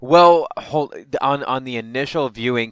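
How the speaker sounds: cutting in on co-host, loud and high; loud interruption. Real, not acted. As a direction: cut in loudly, high.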